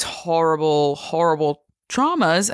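emphasis lands on traumas; stress on traumas. Shown as a demonstration. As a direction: lean on the word traumas, horrible, horrible traumas. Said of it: "the emphasis here is on the word traumas".

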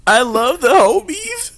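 high, slow mock sincere voice; drawn out, squeaky declaration; high slow put-on voice.

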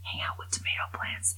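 voiceless aghast whisper; stunned whisper. Shown as a demonstration. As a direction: hush it in disbelief. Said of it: "That is what this says".

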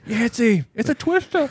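high excited call across the room; high-pitched alarm over the twister. Retold or imitated. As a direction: call it out like the storm is hitting. Calling out about the storm.

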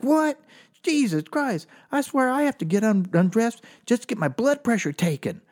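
high-pitched, exasperated girlfriend impression; unsympathetic girlfriend. Comic imitating girlfriend with no sympathy, high and exasperated. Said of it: "my girlfriend had no sympathy".

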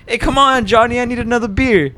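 put-on high, pushy drunk coworker voice; coworker Isaac. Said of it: "he's like".

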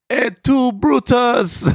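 very high, loud Italian American cry; Italian accent. Retold or imitated. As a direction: Brutus betrays him, so wail it street style. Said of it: "it was".

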